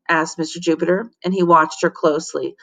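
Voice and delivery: ordinary delivery, nothing marked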